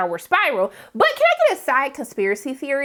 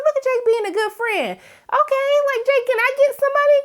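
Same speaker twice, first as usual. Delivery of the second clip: mock begging; 13 semitones higher, 3 dB louder than usual. Begging Jake for money, joking.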